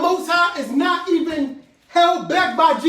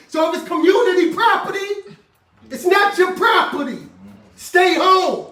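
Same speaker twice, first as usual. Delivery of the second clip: loud, raised preaching push; preaching shout; 4 semitones higher, 6 dB louder than usual. Real, not acted. Preacher to congregation, loud emphatic shout. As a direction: drive the warning home at full voice. Preacher drives home a warning.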